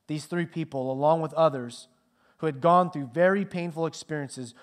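ordinary talk, nothing marked

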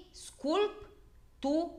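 sculptură spoken slowly, split into syllables. Shown as a demonstration. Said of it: "îl despărțim". (despărțire în silabe, rar)

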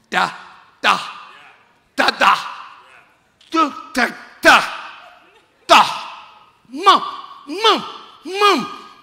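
put-on rising baby babble; baby talking. Shown as a demonstration. Preacher mimicking a baby's first babble. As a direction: babble like an infant learning words. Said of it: "It's like a baby talking".

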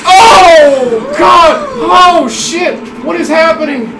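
high, loud burst of alarm; startled yelling. Real, not acted. Player jolted by what is happening in game, startled yelling. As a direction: yell out in shock.

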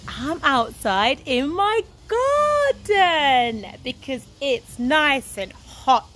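plain reading voice

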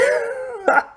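squeaky burst of laughter; high-pitched laugh. Real, not acted. Laughs after rant.

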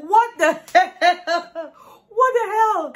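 high, slow, laughing what the hell; laughing disbelief. Real, not acted. Vlogger reacts to the couple still arguing with laughing disbelief.

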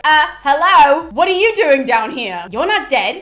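loud, slow call across the water; yelling over. Retold or imitated. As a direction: shout across the river at the living. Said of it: "he yells over to them".

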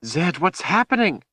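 high, frightened question; alarmed. A narrator's reading. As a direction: ask urgently, pitched high with alarm. Woman alarmed at gasping wizard.

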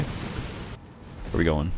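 slow, soft hushed taunt; mock whisper. Stalking the opponent into the hole, mock whisper. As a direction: breathe it sinisterly.

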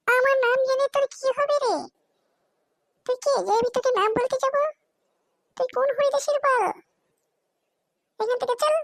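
put-on snappy girl voice.

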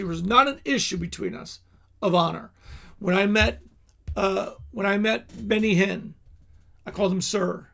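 ordinary delivery

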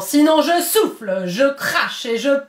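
the wolf at the stone house, slowing the threat; the wolf at the stone house, loud and slow; wolf's demand. Acted.

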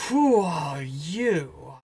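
very slow, drawn-out demand; questioning scientist. Acted. Scientist half facing the camera questions the intruder, slow and drawn out. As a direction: stretch out each word suspiciously. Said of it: "and he's like".

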